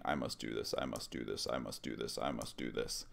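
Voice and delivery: low, soft monotone chant; super low, demonic. Retold or imitated. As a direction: imitate a possessed man repeating himself in a low drone. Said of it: "in a super low, demonic sounding voice".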